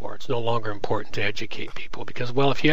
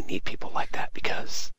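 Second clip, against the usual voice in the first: whisper; 4 dB quieter than usual. Real, not acted. Sneering aside about the minister.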